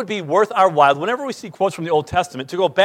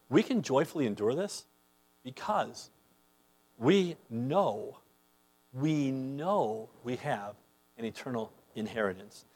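hushed confession; 6 semitones lower, 15 dB quieter than usual. Acted. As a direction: drop to a near-hush, slowing on we know. Believers' quote, hushed and slow.

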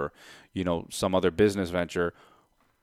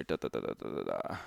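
mumbled da da da; 11 semitones lower, 11 dB quieter than usual. Real, not acted. Filler while doing math.

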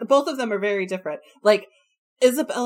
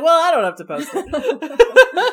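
laughing quote; 8 semitones higher, 8 dB louder than usual. Retold or imitated. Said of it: "I'm like".

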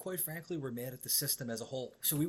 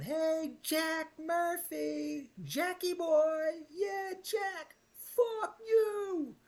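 much higher, loud drawn-out jeering shout; taunting yell; 5 dB louder, much slower than usual. Retold or imitated. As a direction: stretch his name out to taunt him. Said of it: "and I'm going".

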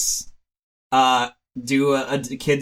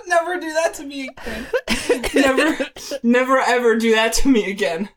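laughing; 7 semitones higher than usual. Real, not acted. Recovering from hysterical laughter.

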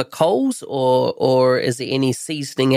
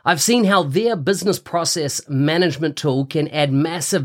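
ad read. Acted. Sponsor ad read on podcast.

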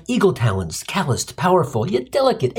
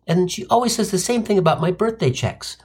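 normal talking voice

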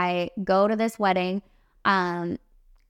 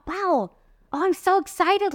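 higher, gushing with delight; thrilled squeal of gratitude; excited; 10 semitones higher than usual. Retold or imitated. Artist seeing guests hand tips to bride, gushing excitedly. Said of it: "And I thought".

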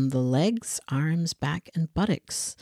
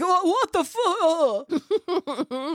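high, slow drawn-out squeal through a laugh; shocked squeal; 12 semitones higher, much slower than usual. Retold or imitated. Spilling wine at the photos, a high laughing shriek.

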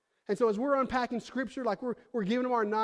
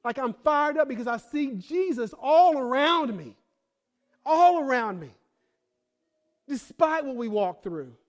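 louder, higher, slow fervent preaching; fired up preaching; 4 semitones higher, 6 dB louder than usual. Real, not acted. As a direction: preach it with fire. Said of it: "you think I get fired up".

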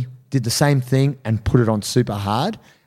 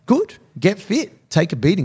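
high mocking taunt; 8 semitones higher than usual. Mock taunt to a coward.

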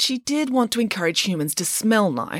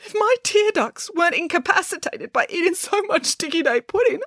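very high, overwrought character voice; distraught Boris; 13 semitones higher than usual. A narrator's reading. Boris protesting the terrible story, distraught high voice. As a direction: wail it melodramatically, high up.

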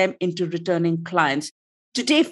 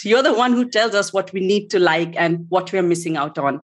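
normal talking voice

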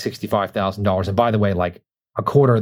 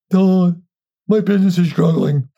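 high, louder mock plea; whiny struggling client; 7 semitones higher, 4 dB louder than usual. Coach imitates a left-behind wholesaler pleading with him, high whine. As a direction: whine it like a failing client begging for help. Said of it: "looking like".

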